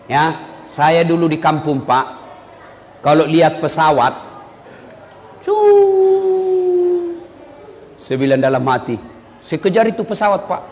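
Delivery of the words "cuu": plane whoosh imitation.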